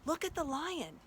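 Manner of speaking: put-on high, urgent girl's cry to her sister; eager young girl calling; excited Lucy. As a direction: point it out in wonder. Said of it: "Lucy said".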